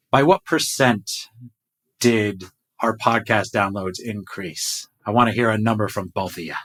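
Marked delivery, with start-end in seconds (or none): none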